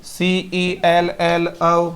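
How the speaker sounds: slow letter-by-letter spelling; spelled out letter by letter. Shown as a demonstration. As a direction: spell it out slowly, each letter apart. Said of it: "spelling out letters of word". (धीरे-धीरे अक्षर-अक्षर spelling)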